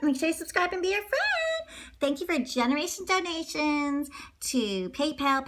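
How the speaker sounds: ordinary delivery, nothing marked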